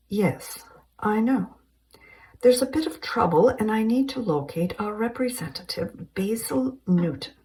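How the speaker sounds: high, light, polite English lady; a prim British woman on the phone; British caller. Acted. Helen speaking.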